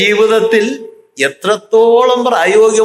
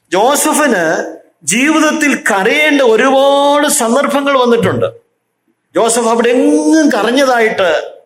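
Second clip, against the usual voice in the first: higher, ringing pulpit voice; fervent preaching; 7 semitones higher than usual. Acted. Preacher pressing his flock on Joseph's silence, fervent and raised. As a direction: preach it out to the congregation.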